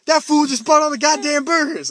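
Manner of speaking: high, loud mock cashier; sassy cashier. Cashier blaming the customer ahead, high sassy voice. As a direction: complain with sass, high and loud. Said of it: "cashier voiced in the story".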